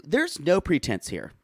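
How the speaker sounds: ordinary talk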